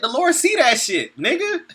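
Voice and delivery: high, loud laughing exclamation. Real, not acted. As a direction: crack up while you say it. Laughing preacher makes his point.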